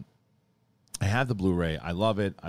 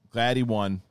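ordinary delivery

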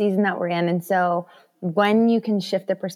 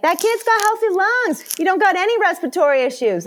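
much higher, bright mock praise; playful cheer; 12 semitones higher, 5 dB louder than usual. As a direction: cheer the kid's lungs like a fan. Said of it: "'cause I was like".

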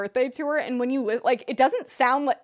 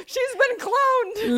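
mock shocked voice; 13 semitones higher, much slower than usual. Mocking friend's reaction, high voice.

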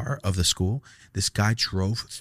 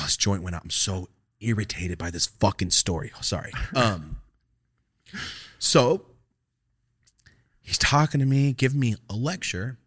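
ordinary talk, nothing marked

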